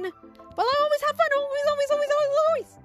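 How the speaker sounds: very high, loud chirpy chanting; hyper kid. Acted. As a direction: squeal it in an unstoppable stream. Hyper kid answers teacher back.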